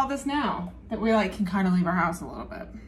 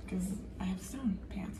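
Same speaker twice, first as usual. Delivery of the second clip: unvoiced whisper, much softer; whispered aside; 3 semitones lower, 13 dB quieter than usual. Real, not acted. Telling viewers to use any pan, whispered aside. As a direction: slip it in as a hushed aside. Aside about her stone pans.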